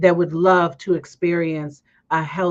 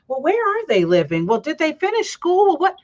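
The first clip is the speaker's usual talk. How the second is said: higher-pitched prying questions; mock nosy questioner; 9 semitones higher than usual. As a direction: imitate an invasive busybody, pitched up. Mocks nosy church questioners.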